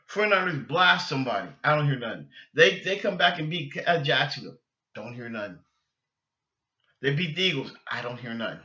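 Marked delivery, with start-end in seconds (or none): none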